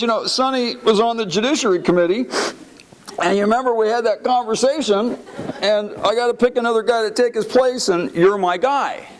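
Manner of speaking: high-pitched hammed-up tearful voice; Henry Hyde crying. Weeping chairman recruiting colleague at funeral, tearful. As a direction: imitate blubbering through a job offer. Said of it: "while he's crying, he says this".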